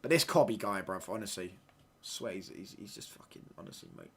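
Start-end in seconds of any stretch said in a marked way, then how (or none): 1.6-4.1 tearing up. Real, not acted. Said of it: "I'm gonna tear up".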